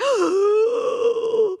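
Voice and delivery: a sharp, high gasp of imitated terror; terrified gasp. Student gasping in terror at a phone call. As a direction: suck in air like a panicked kid. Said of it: "It's like this terror reaction".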